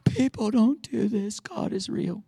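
acted breathless amazement; stunned awe. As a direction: marvel at it, stretched out then hushed. Stunned by an undeserved gift.

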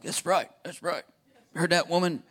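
lightly voiced smug men agreeing; men in the congregation. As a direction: mimic the men chiming in. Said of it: "All the men are like".

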